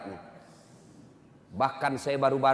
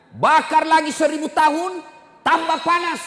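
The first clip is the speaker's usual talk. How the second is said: preaching at full force; 11 dB louder than usual. Acted. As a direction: drag it out like a warning of doom. Fiery sermon on hell.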